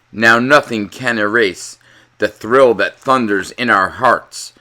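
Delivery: plain reading voice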